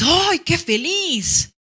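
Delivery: high, drawn-out joyful cry; overjoyed exclamation. Shown as a demonstration. Teacher acting out being super happy for the class. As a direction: gush it slowly like pure delight. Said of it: "cuando estás recontra feliz".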